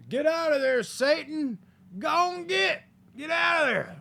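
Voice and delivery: high, loud hammed-up exorcism; mock a priest yelling a demon away; movie exorcist shout. Mock priest casting Satan out of the possessed, hammed-up shouting.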